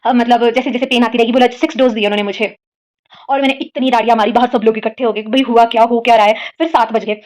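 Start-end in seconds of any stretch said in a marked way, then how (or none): none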